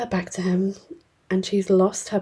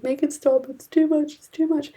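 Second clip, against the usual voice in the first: high-pitched frantic pleading, acted out; overwhelmed mock plea; 8 semitones higher than usual. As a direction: imitate a tormented king begging for it to stop. Acts out king overwhelmed by visions.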